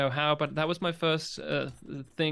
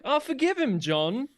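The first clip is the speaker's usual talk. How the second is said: much higher, pleading mock Delenn voice; 9 semitones higher, 3 dB louder than usual. Host mocking Delenn's plea to John, exaggerated high pleading. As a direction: plead sweetly in a mocking lilt. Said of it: "Delen's like".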